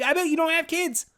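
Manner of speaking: jab it way up high; high, sarcastic dig; high mock jab. Real, not acted. Mocking late-night people.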